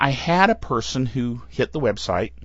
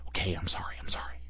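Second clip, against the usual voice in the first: whispered apology; 7 semitones lower, 13 dB quieter, much faster than usual. Real, not acted. Apologizing after pronunciation joke.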